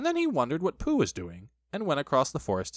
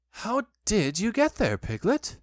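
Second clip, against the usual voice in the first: higher-pitched casual question; ordinary boy voice; 8 semitones higher, slower than usual. A narrator's reading. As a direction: ask it in an everyday child's voice. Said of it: "in his ordinary voice".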